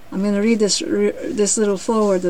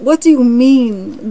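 loud, slow challenge; indignant lion; 4 semitones higher, 9 dB louder, slower than usual. A narrator's reading. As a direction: push the question out, drawn out. Lion protests the cricket's advice.

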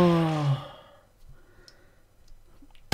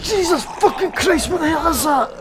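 high, loud, startled yelling; shouting at a monster that spat at him; real shouting; 8 semitones higher, 7 dB louder than usual. Real, not acted. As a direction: scream in shock and disgust. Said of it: "I'm shouting because".

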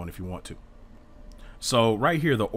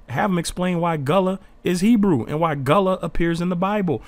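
raised, forceful voice pushing back at critics; heated, loud insistence; 6 semitones higher, 6 dB louder than usual. Real, not acted. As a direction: drive it home loud and insistent. Heated rebuttal to doubters.

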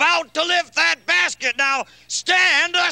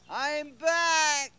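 fake genie voice; 4 dB quieter than usual. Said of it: "Your voice has changed".

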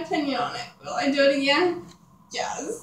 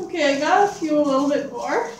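usual speaking voice

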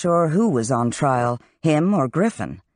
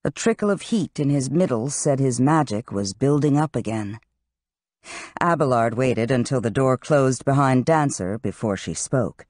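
plain reading voice

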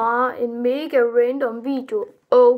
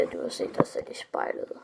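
whispered aside, no voice; whispered jab; 10 dB quieter, faster than usual. Real, not acted. Youtuber whispering a burn at a hater. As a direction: hiss the burn under your breath.

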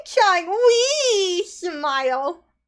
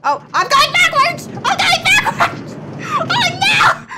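high, loud panicked squeals; excited yelling; 12 semitones higher, 3 dB louder than usual. Real, not acted. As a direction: shriek as the ride goes wrong. Going backwards on the ride.

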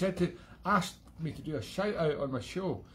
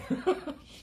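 breathy high laugh; 4 dB louder than usual. Real, not acted.

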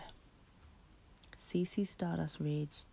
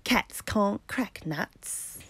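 teasing squirrel; 7 semitones higher, 7 dB louder than usual. Acted. Squirrels mock the kitten.

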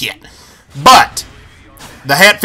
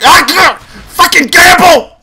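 slow, higher, crying yell; anguished yell; 8 semitones higher, slower than usual. Real, not acted. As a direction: howl it in frustration. Gets killed, cries out.

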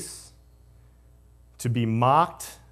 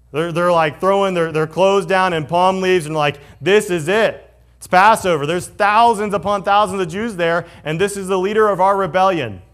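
much louder and higher, thrilled and cheering; excited crowd; 5 semitones higher, 7 dB louder than usual. Retold or imitated. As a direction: build the crowd's frenzy, loud and high. Excited crowd at triumphal entry.

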